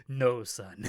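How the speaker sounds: a hint of a ghostly father; Mufasa. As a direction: intone it lightly as a fatherly apparition. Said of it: "like, a Lion King thing, like, with the Mufasa ghost".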